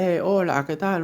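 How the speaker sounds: high, cheerful hello to the whole room; loud bar greeting. Retold or imitated. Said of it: "si entra a un bar y saluda a todo el mundo".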